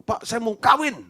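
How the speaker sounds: high, loud, insistent demand; loud and firm. Retold or imitated. Daughter tells the pastor firmly she wants to marry, loud and firm. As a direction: blurt it out firmly, no doubt. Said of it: "itu ngomongnya kenceng dan tegas".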